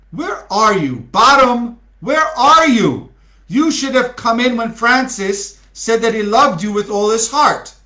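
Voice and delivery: loud, high call for a missing actor. Acted. Director calling out for Bottom to come in. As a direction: call out across the stage.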